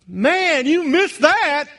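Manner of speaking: high, slow, indignant mock reproach; mock complaint to God. Believer in heaven faulting God, high, slow mock reproach. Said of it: "you'll not go".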